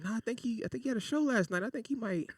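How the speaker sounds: put-on teen lying at the door, high and nervous; nervous lie. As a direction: stall her with a high nervous fib.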